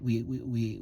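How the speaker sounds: ordinary delivery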